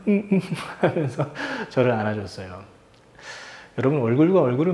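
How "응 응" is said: small high sobbing assent; imitated tearful yes. Crying son agreeing to hug dad, mock-tearful high voice. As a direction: answer like a child through tears. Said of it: "울면서 막".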